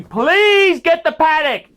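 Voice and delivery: high, loud, slow begging; pleading shout. Real, not acted. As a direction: beg him at the top of your voice. Begging viewer to buy.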